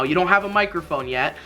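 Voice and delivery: ordinary talk, nothing marked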